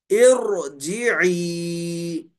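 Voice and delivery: इरजीई stretched slowly, ra thick; heavy ra. Shown as a demonstration. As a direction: draw out इरजीई with a full ra. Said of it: "रा को मोटा पढ़ेंगे". (मोटा रा, धीमा उच्चारण)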